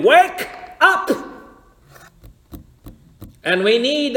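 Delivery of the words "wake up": loud, high wake-up shout, drawn out; retold shout. Preacher voicing the midnight cry to the sleeping virgins, shouted. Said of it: "someone shouted".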